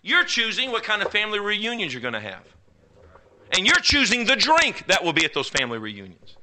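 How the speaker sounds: high, building sermon cadence; preacher pressing the choice; preaching. Acted. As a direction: drive the point home to the congregation.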